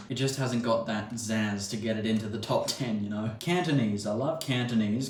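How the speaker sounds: ordinary delivery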